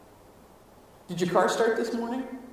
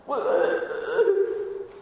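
mock nervous hesitation; 13 semitones higher than usual. Anxious soul hesitating.

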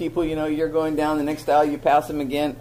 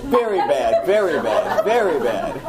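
laughing, higher, scolding herself; laughing at her own bad behaviour, scolding herself; self-scolding laugh; 7 semitones higher, much slower than usual. Real, not acted. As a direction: scold yourself through real laughter. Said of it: "I confess all my sins to you".

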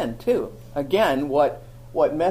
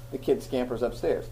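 ordinary talk

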